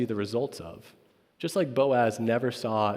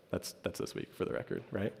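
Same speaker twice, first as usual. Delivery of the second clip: unvoiced whisper, much lower and softer; whispered aside; 5 semitones lower, 12 dB quieter than usual. Real, not acted. Pastor whispering a side note to chapel students. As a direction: slip it to the crowd under the breath.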